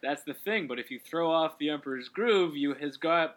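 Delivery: normal talking voice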